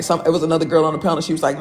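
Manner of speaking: normal talking voice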